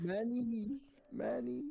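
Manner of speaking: high, laughing call of the name; calling out teasingly. Retold or imitated. Imitating the kid calling the teacher by nickname. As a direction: toss the nickname out playfully.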